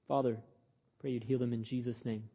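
low, hushed short prayer; quiet prayer. Shown as a demonstration. Preacher showing how to pray for a friend, quiet prayer. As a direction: pray softly and low over someone. Demonstrates a quick prayer for someone.

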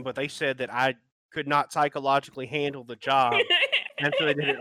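loud, laughing mock cop voice; mocking a dumb cop. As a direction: play the dim policeman, loudly. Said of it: "they're the kind of guy who".

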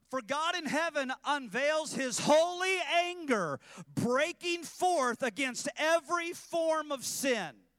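loud, high, slow, hammering each word; preaching at full force. Acted. Preacher warning the congregation, loud and high. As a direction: thunder it from the pulpit.

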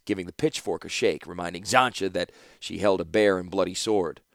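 ordinary delivery

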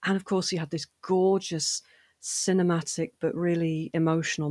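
ordinary talk, nothing marked

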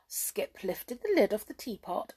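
ordinary delivery

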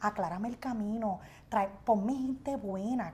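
quiet, slow pleading prayer; soft prayer. Retold or imitated. Praying to God for good people, quiet and slow. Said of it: "y yo digo".

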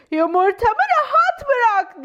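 high, loud, slow squawk; furious high bird screech; squawking mother bird. Acted. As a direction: shriek it like an angry mother bird guarding eggs. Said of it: "diye ciyakladı".

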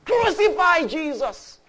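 loud, high, drawn-out shout; slow, full-voiced crowd yell; crowd crying out. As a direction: cry it out like a mob demanding a death. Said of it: "they cried".

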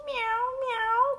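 very high, drawn-out meows; two high kitty cries; cat meowing. Acted. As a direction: mew like a storybook cat. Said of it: "cried the cat".

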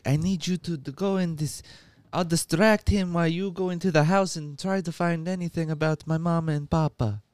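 a hammy Italian accent, lightly put on; Pistachio's movie voice. Pistachio begs Jennifer to search the house, Italian accent. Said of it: "That's how he talks in the movie".

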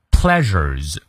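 Pleasures with the middle s said as a retroflex buzz, between zh and r; Pleasures with careful middle sound. Shown as a demonstration. Teacher demonstrating the middle sound of Pleasures. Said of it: "中间发r-r-r的音".

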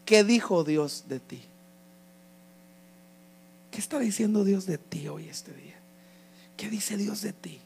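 soft, slow reflective preaching; hushed altar appeal. Real, not acted. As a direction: let each question sink in quietly.

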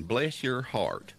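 slow Southern "Bless your heart", drawn out; Southern drawl. Pretend. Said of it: "that's called cussing in Southern".

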